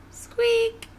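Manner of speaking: high-pitched squeaky cry; tiny, high squeak; little lion's squeak. Acted. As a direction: squeak the word like a tiny animal. Little lion squeaks instead of roaring.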